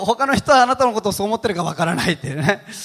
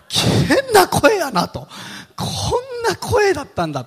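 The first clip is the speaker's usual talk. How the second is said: appalled realisation; 13 semitones higher, slower than usual. Retold or imitated. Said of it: "認識した時に吐き気がしましたね".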